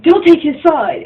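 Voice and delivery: "Don't take his side." snapped high and loud; snapping. Retold or imitated. Jealous boyfriend snapping at his girlfriend, loud. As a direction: bite it off as an angry order. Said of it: "And Castell snaps at her".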